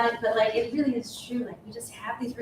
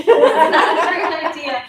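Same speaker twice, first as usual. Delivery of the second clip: higher and louder, laughing through the words; laughing repeat; 6 semitones higher, 10 dB louder than usual. Retold or imitated. To the group, repeating the warning with a laugh. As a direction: laugh while echoing the advice.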